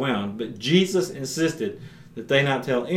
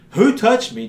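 sharp question; 9 semitones higher, 6 dB louder than usual. Acted. Jesus asks in the crowd.

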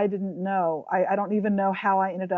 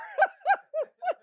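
high squealing laugh. Retold or imitated. Fangirl moment retold.

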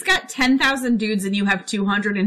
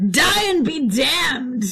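slow, drawn-out mock curse from Pistol; hammed-up curse; 6 semitones higher, much slower than usual. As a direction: stretch the curse out like a stage villain. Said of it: "And then Pistol's like".

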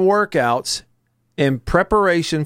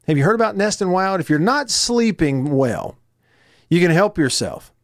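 usual speaking voice

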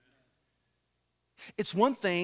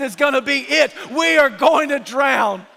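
a disciple shouting in fear of the storm; panicked cry; 7 semitones higher, 11 dB louder than usual. Acted.

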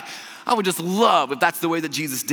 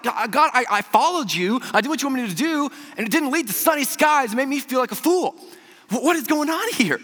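frustrated prayer; 11 semitones higher, 5 dB louder than usual. Retold or imitated. Complaining prayer to God.

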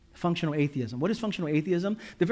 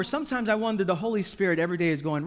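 ordinary delivery

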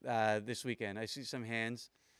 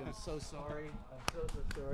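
much softer, repeated apology; hushed apology; 8 dB quieter than usual. Real, not acted. Host hugging the guest he let down, in a hushed apology. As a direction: drop the volume and apologize close up.

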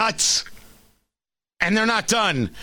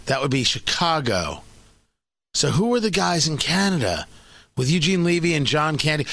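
ordinary delivery

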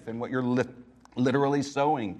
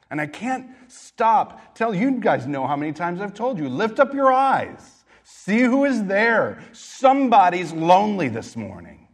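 fervent preaching; 5 semitones higher, 5 dB louder than usual. Real, not acted. Pastor urging congregation to reach out.

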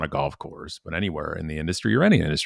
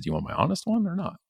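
higher, quick teasing question; mock-coy, higher and fast; cheeky offer; 11 semitones higher, faster than usual. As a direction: dangle the honest answer playfully. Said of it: "I'm like".

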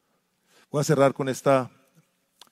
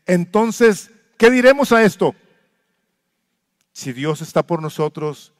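preaching; 4 semitones higher, 8 dB louder, slower than usual. Acted. Preaching Romans passage.

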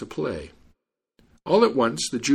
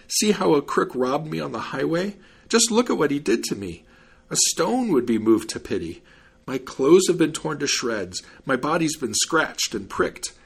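higher-pitched plaintive appeal; storybook wronged man, woeful; aggrieved victim, lamenting; 4 semitones higher than usual. Acted. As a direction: plead your case to the judge. Victim complains to the judge.

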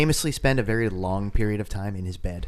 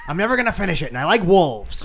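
higher-pitched joking author impression; George R R Martin; 6 semitones higher, faster than usual. Friend mimics an author on request, joking impression. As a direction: play a novelist with a quick comic impression. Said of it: "That's my George R R Martin".

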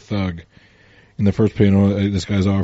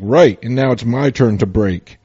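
louder, slow, punching the last word; mock tough Superman; 2 semitones higher, 7 dB louder, slower than usual. Superman turning on the thug, hammed-up tough. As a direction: deliver the comeback like a hero's threat.

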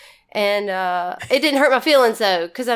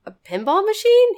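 high, incredulous question; disbelieving, as if you misheard; surprised and disbelieving; 9 semitones higher than usual. Retold or imitated. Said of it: "I was like".

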